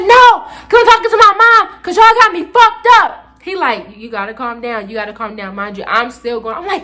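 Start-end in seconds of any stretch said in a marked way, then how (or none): 0.0-3.2 high, loud outraged refusal; defiant outburst. Retold or imitated. Student refusing to hang up on mom, high loud defiance. 4.0-5.5 quiet, soothing repetition; calming principal. Retold or imitated. Principal calming student still going, hushed and soothing. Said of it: "he calmed me down".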